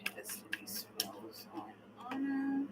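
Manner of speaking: very soft murmur, slowing down; murmured card question. Real, not acted. Tarot reader asking the cards about spells on a client, murmured. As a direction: murmur the question under your breath.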